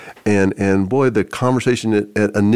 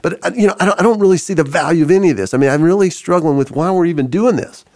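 higher-pitched, fast, emotional challenge; raised pitch, pressing the objection hard; butting in upset; 8 semitones higher, 3 dB louder, faster than usual. Retold or imitated. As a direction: imitate a woman interrupting with a pointed objection. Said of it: "she just kinda butted in and said".

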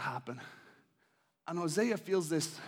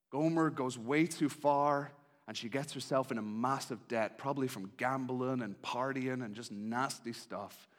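ordinary talk, nothing marked

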